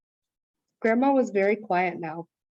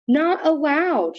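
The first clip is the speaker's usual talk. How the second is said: scolding; 7 semitones higher, 5 dB louder, slower than usual. A narrator's reading. Sister scolding wet boots.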